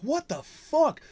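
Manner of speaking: high-pitched mock shock; retold alarmed reaction; acted startled disbelief. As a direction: react like a startled bystander, voice jumping up. Acting out startled coworkers' reaction.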